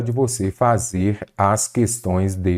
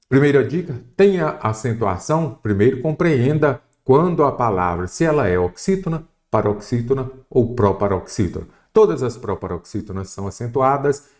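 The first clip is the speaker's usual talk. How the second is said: ordinary talk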